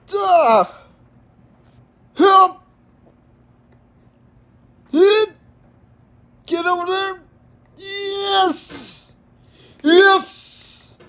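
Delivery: high, loud frantic cries; excited yelling. Real, not acted. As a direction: yell at the game in panic. Frantic climbing moment.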